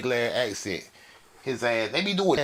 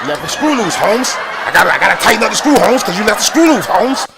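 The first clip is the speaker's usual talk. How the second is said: mock coworker; 6 semitones higher, 10 dB louder than usual. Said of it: "fix the dumb ass shit".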